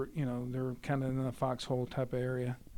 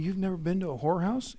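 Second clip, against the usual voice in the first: quick, higher, louder incredulous question; retold disbelief; 5 semitones higher, 5 dB louder, faster than usual. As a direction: imitate a buddy who can't believe it. Robert questions his friend, retold.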